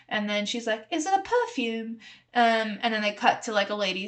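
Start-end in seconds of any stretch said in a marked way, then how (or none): 0.9-2.0 put-on British accent.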